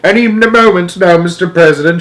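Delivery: loud, high, frantic report; urgent alarm. A narrator's reading. Astronaut about to lose contact, alarmed. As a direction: warn urgently over the radio.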